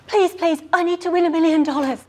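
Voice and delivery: high, loud, gasping plea; urgent pleading. Acted. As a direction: beg the host desperately. Contestant begs host for help.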